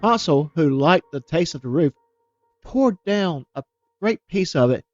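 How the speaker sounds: ordinary delivery, nothing marked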